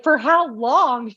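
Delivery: slow, high disbelieving question; disbelief. Retold or imitated. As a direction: stretch it out in shock. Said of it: "I was like".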